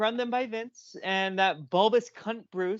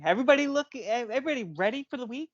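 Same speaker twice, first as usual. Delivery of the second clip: normal talking voice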